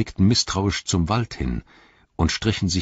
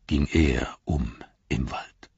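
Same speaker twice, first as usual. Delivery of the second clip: whispered verse ending; 5 semitones lower, 4 dB quieter, slower than usual. Acted. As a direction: whisper the last line, slow and low. Last line of riddle verse. (geflüsterter Schluss des Verses)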